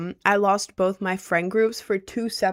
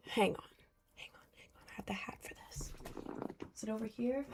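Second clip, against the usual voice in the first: whisper; 17 dB quieter, faster than usual. Acted. Secretive hat hunt.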